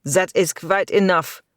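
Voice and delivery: impatient. A narrator's reading. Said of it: "he said impatiently".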